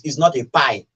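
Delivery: ordinary talk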